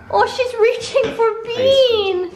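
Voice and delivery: very high, slow cooing voice. Real, not acted. Cooing at pet.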